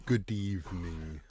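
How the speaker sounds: slow, low vampire greeting. Pretend. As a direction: drawl it like a vampire count. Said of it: "That's my, my vampire voice".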